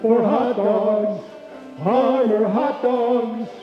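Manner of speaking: ordinary delivery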